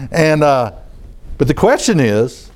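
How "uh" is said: usual speaking voice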